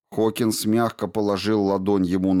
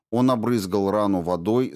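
ordinary delivery, nothing marked

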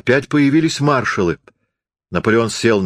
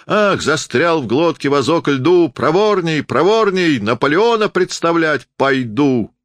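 hoarse hawker cry; 6 semitones higher than usual. A narrator's reading. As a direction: cry out to the crowd like a fairground barker. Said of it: "кричал сиплым голосом". (сиплый крик зазывалы)

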